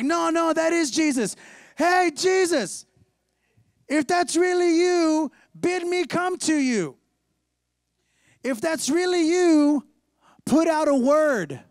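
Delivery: loud, high, drawn-out shout; calling out. Acted. Peter calling to Jesus walking on the water, loud stretched shout. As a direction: holler slowly across a distance.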